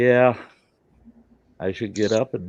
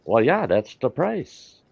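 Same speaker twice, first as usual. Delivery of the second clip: Omar's voice; 6 semitones higher, 4 dB louder, slower than usual. Retold or imitated. Omar the camel dealer answers.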